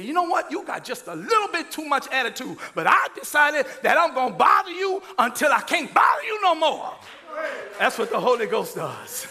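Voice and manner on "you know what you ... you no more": stern nun. Retold or imitated. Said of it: "She said".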